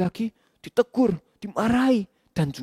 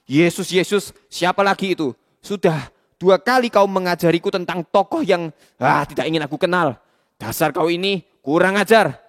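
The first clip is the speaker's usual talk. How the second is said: loud angry tirade; furious master; 4 dB louder than usual. Acted. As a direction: bark cruel orders. Boss enraged, orders torture.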